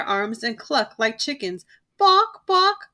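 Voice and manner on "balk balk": high chicken clucks; clucking. A narrator's reading. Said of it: "they clucked".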